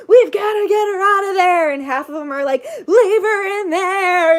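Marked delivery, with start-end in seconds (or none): 0.1-1.7 high, loud urgent shout; strained shout to the other rescuers; panicking men. Retold or imitated. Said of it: "men just panicking". 2.9-4.4 high, loud, drawn-out yell; stretched wailing shout over the commotion; panicked rescuer's shout. Retold or imitated. Other rescuers shouting back.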